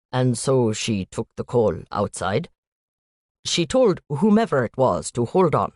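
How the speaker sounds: put-on light Indian accent, statements lifting at the ends; a hint of an Indian student, worried uptalk. Student telling the agent about Noelle, light Indian accent. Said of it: "a young man with an Indian accent".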